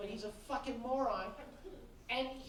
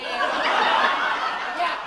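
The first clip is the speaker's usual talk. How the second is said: laughing; 2 semitones higher, 12 dB louder than usual. Real, not acted. Laughing at the lady.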